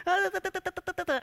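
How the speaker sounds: high, loud imitated stammer on "da"; mock stutter. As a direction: stammer out "da, da" like a nervous Moses. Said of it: "I stutter, you know".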